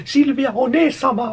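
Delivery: high, slow anime-style call; imitación de personaje. Acted. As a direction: mimic a girlish anime character, drawn out. Mimics the game character's call. (voz aguda imitando anime)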